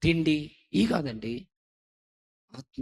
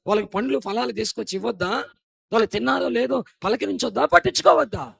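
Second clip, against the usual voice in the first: louder, fast questioning preaching ending in a high shout; reproachful preaching; 8 semitones higher, 9 dB louder than usual. Acted. Preacher scolding sons neglecting parents, rapid reproachful preaching. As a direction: fire the questions at them.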